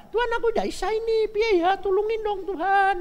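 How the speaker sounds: whiny plea to God. Pretend. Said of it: "Ndak isa ya bilang".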